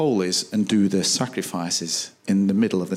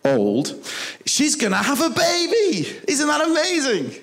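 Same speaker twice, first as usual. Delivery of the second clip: excited angel; 10 semitones higher than usual. Retold or imitated. Preacher voicing the angel telling Zachariah of a baby, higher and excited. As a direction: announce it like thrilled good news from heaven.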